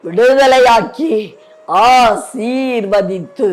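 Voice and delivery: slow phrases fading down to a hush; closing prayer. Real, not acted.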